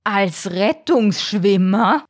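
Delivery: slow, incredulous question; stunned disbelief. A narrator's reading. Father shark repeats his son's plan, stunned. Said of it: "vor lauter Fassungslosigkeit".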